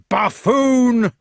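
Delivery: high, very loud insult; crying out. A narrator's reading. Fat knight to the man up the tree, crying out. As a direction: shout it with scorn. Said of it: "cried the fat knight".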